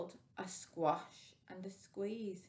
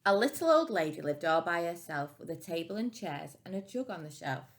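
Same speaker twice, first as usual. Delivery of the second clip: plain reading voice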